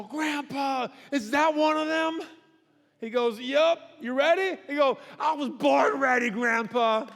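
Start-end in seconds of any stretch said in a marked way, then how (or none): 0.0-2.2 put-on higher excited child voice; eager grandkid. Kid asking about giant. 3.4-4.6 higher, louder pep call; rallying grandpa. Acted. 5.2-7.1 high, loud brash shout; bold kid shout. Acted. Kid answering boldly.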